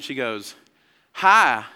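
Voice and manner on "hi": put-on high, loud, sweet Southern-girl greeting; playful mock Southern drawl, pitched up; cute Southern accent. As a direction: imitate a cute Southern girl introducing herself. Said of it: "in this really cute Southern accent".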